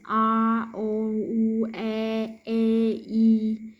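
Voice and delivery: each vowel letter drawn out separately, like "R, O, U"; voiced vowels. Shown as a demonstration. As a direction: hold "R, O, U, E, A, E" one by one, fully voiced. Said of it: "all vowel sound are voiced".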